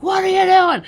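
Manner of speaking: high, loud challenge from the back door; startled shout. Retold or imitated. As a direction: shout it to scare someone off in the dark. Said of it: "and I'm like".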